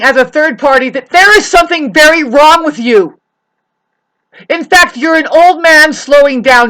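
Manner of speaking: loud, high, forceful scolding; angry rant. Real, not acted. Berating a neighbor over parking and asphalt, angry shouting.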